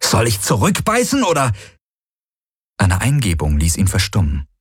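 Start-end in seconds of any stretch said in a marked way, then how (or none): 0.0-1.5 high, exasperated retort; angry desperation. A narrator's reading. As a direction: fire back in helpless anger. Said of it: "ohne seine eigene Wut oder Verzweiflung zu verhehlen". (wütend und verzweifelt)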